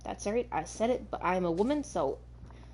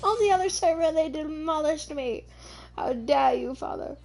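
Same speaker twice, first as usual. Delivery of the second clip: put-on high, loud, indignant child voice; voicing an upset baby dragon; 8 semitones higher, 4 dB louder than usual. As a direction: wail the accusation at father. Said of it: "You saying".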